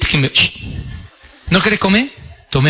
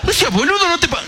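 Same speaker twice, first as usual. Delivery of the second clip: much higher squeaky guest; 10 semitones higher than usual. Acted. Guest brushing off the host, high squeak. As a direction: pitch it way up as the guest.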